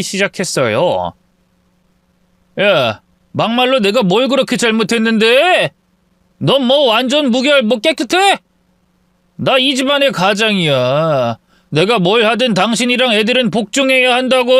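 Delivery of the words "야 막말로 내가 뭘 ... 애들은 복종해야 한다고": lightly voiced husband in a raging outburst; furious husband. Drunk husband rages at wife, a mildly voiced angry man. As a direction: bark it at her, self-righteous. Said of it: "불같이 화를 내기".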